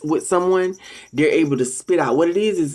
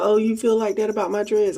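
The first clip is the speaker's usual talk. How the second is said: much higher, sweetly surprised question; fake-innocent bait; 9 semitones higher than usual. To a troll mocking her dreads, sweetly baiting in a higher voice. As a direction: feign innocence to set the trap. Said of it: "So I'll be like".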